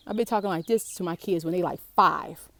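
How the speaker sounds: usual speaking voice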